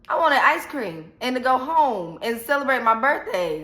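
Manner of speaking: ordinary talk, nothing marked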